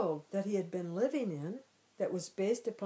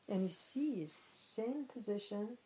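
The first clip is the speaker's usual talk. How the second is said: ordinary talk, nothing marked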